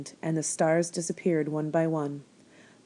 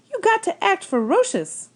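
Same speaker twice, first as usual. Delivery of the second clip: put-on much higher, louder old woman calling out; 6 dB louder than usual. A narrator's reading. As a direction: holler it at the rabbit like a farm wife. Old woman urging scarecrow rabbit.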